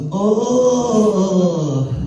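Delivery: long, high mock moan; man moans. Imitating moans from the club next door, long high moan. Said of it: "With all those man moans".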